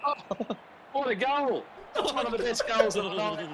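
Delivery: laughing, high excited call. Real, not acted. Commentator sees a late goal.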